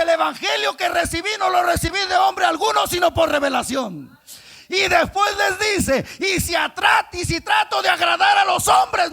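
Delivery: loud, high preaching at full force; fiery preaching. Acted. Preacher shouting the story of the cross to the church. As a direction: shout it to the congregation.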